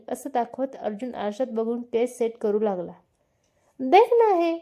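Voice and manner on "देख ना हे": higher and louder burst of enthusiasm; excited gushing. Acted. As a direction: gush about him eagerly. Maya excitedly describes Malhar.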